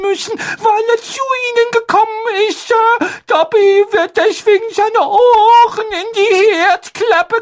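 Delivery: very high, timid elf voice; a fretful little house-elf; squeaky house-elf voice. Acted. Dobby the house-elf speaks.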